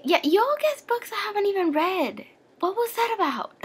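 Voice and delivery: high-pitched, incredulous scolding; playful high-pitched disbelief; mock indignation. Real, not acted. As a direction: call them out in a high, baffled voice. Mock-indignant at viewers' guesses.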